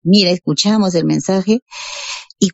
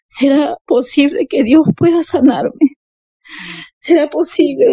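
tearful wondering; 6 semitones higher than usual. Retold or imitated. Sick woman wondering whether God could heal her, tearful wondering. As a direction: wonder aloud, voice raised and close to tears.

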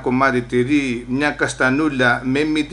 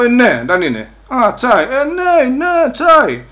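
high, loud rush of agreement; excited confirmation; 8 semitones higher, 5 dB louder, slower than usual. Acted. Immigrant confirming to the officer it is only tea, excited. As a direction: exclaim it high, stretched with eager relief.

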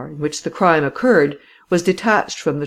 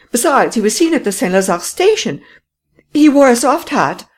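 high, loud, agitated woman's voice; excitable lady; 7 semitones higher, 4 dB louder than usual. Acted. Voices Madame Renaud.

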